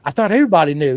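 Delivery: ordinary talk, nothing marked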